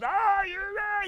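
high, slow mock scream. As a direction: wail it like a madman. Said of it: "he's screaming".

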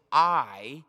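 "I" stressed loud and firm; strong emphatic I. Shown as a demonstration. Preacher repeating God's word, strongly emphatic "I". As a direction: lean hard into "I". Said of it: "it's strong".

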